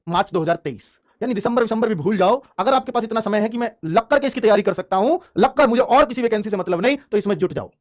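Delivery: usual speaking voice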